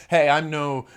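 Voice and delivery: usual speaking voice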